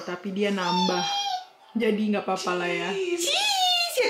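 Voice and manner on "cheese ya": very high coaxing cheese; sing-song prompt. Real, not acted. Mother prompting a photo.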